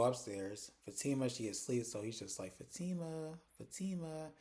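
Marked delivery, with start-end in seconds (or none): 2.6-4.3 soft, higher-pitched sing-out of a name; gentle wake-up call. Retold or imitated. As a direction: coax a sleeper awake, softly. Waking sleeping Fatima gently.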